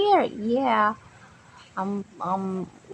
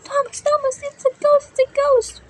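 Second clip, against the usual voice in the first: high, loud frightened cry; scared shout; 13 semitones higher, 6 dB louder than usual. Acted. Engine shouts to Thomas in fright. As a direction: yell the warning in panic. Panics at ghost behind.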